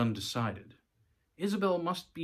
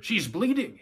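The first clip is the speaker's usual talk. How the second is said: high, loud alarmed call; 9 semitones higher, 5 dB louder than usual. A narrator's reading. Voter summons help for bleeding woman, calling out. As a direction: call urgently across the room. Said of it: "he called out".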